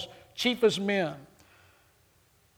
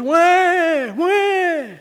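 high, mocking crybaby wail; mock crybaby wah; 10 semitones higher, 6 dB louder than usual. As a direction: sneer out a babyish "wah, wah". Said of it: "he looks at him and goes".

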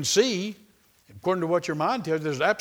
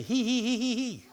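high-pitched put-on tittering; little mock giggle up high; fake hee-hee giggle; 8 semitones higher than usual. Shown as a demonstration. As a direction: giggle on purpose, syllable by syllable. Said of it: "You can say".

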